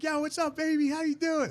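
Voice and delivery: cheery buddy greeting him in a high voice; imitating a hype bro greeting. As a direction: pitch it way up like an overexcited buddy. Friend's greeting voiced in story.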